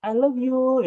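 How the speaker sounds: high, drawn-out mock-sweet confession; gushingly loving. Shown as a demonstration. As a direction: imitate a gushing lover, voice lifted high. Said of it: "beda ya, sama-sama katanya". (nada penuh cinta yang dibuat-buat)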